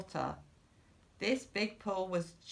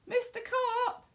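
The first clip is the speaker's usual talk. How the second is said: very high calling out; worried boy shouting a name; yelled. A narrator's reading. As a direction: call out across the pool, high. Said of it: "I yelled".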